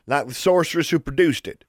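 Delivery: high, mocking character voice; jeering. A narrator's reading. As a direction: jeer it at her from behind. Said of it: "jeered a voice behind them".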